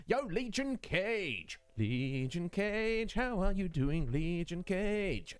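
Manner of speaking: lazy singsong call to a viewer; drawn-out greeting. Real, not acted. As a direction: stretch each word of the name out.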